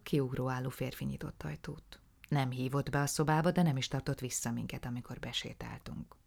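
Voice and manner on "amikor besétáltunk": usual speaking voice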